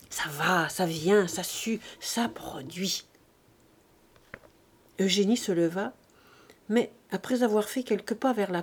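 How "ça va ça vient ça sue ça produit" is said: low, slow and dwindling; hushed relish. A narrator's reading. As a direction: savour each short phrase, sinking toward a whisper. Miser savours how money lives.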